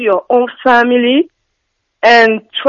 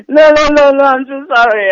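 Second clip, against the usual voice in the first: laughing; 6 semitones higher, 5 dB louder than usual. Real, not acted. Caller laughs at accent remark.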